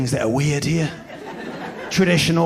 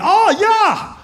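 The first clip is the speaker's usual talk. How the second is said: high, bright-eyed "Oh, yeah!"; excited Swedish host; mock host exclamation; 4 dB louder than usual. Host's reply acted out.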